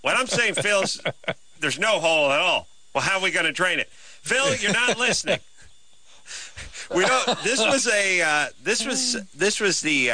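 high, strained and exasperated, laughing at the end; an exasperated inventor losing patience. Acted. Acting out the board designers arguing.

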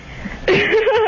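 short high nervous giggle; nervous laugh. Real, not acted. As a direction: giggle from nerves before speaking. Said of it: "That's what you call a, a nervous laugh".

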